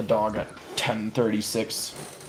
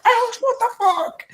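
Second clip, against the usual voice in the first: much higher mock-furious shout of the name; imitated angry dad yell. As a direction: yell like a dad storming downstairs. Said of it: "thundering down".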